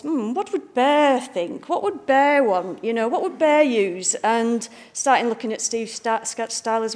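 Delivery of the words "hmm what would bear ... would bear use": higher, slow musing, a touch hammed; pondering aloud. Designer replaying her own thoughts on fonts, musing higher. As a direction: wonder aloud what the bear would pick. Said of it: "I was thinking about fonts".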